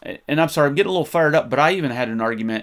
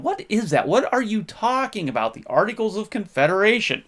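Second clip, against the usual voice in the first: higher mock voice of confused people; puzzled listeners; 8 semitones higher than usual. Imitating listeners who ask him about the Articles, higher puzzled voice. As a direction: play the baffled questioner, pitched up. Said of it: "a lot of people are going".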